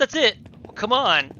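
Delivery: high, loud outraged surprise; loud incredulous complaint; indignant disbelief. Real, not acted. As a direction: protest at the game, loud and high. Reacting to anticlimactic game choice.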